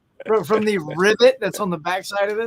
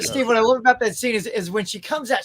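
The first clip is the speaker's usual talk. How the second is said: normal talking voice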